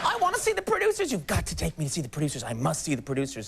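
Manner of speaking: hammed-up maternal pleading, rushed; imitated insistent mom. Comedian mimics mom demanding to see the show, insistent mock-mother voice. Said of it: "and she was like".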